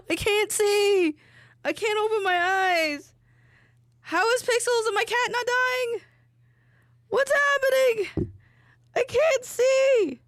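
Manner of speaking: high-pitched panicked wail; high distressed whine. Real, not acted. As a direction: squeal in real distress. Eyes burning from onion fumes.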